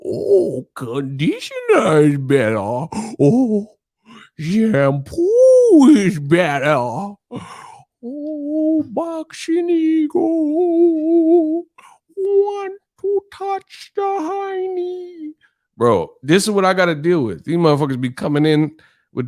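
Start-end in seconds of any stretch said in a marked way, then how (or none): 0.1-6.8 silly singsong squeal, stretching out the vowels; Billy Madison. Acted. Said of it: "this random Billy Madison gibberish". 8.0-15.3 very high, drawn-out wail; Paul Bearer. Acted. Streamer mocking fans who throw him off, high slow wail. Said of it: "Talking like Paul Bearer".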